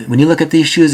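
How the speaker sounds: ordinary talk, nothing marked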